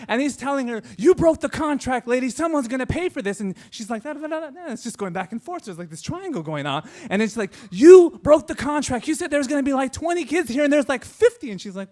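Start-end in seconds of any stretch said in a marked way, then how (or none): 1.0-3.4 imitated irate yell, high and loud; angry yelling. Batman actor yelling at Kevin's mom, angry. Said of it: "he's yelling at her". 4.0-4.7 high-pitched babble standing in for arguing; mimicked rapid retort. Kevin's mom answering Batman, mimicked arguing. 7.7-11.5 loud, high-pitched outraged complaint; angry yelling. Retold or imitated. Batman actor yelling at Kevin's mom, furious. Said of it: "he's yelling at her".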